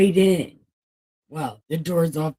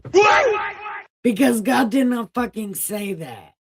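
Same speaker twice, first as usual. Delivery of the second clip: much higher, loud outraged yell; outraged shout; 6 semitones higher, 4 dB louder than usual. Pretend.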